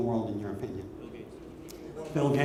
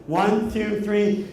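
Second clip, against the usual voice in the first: ordinary talk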